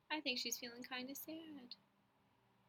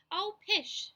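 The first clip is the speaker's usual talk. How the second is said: high, loud scoffing pish; dismissive mother; 8 semitones higher, 11 dB louder than usual. Acted. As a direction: brush the worry off, up high.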